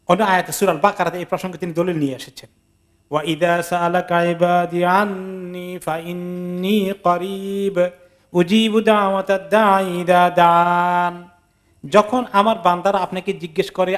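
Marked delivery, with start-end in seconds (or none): 3.1-7.9 slow, drawn-out chanted recitation; Quran recitation. Acted. 8.3-11.3 slow chanted Arabic, উজিবু drawn out long; Quran recitation. Acted.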